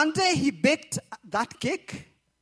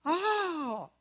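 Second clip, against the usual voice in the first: usual speaking voice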